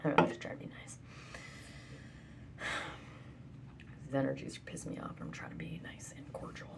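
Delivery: soft unvoiced whisper throughout. Real, not acted. Venting to the viewer about energies in a whisper. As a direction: keep it barely breathed.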